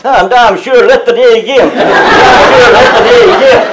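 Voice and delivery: high, loud mimicked watchman; calling out. Wally calls out the latecomer at the clock, loud and high. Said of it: "and Wally calls".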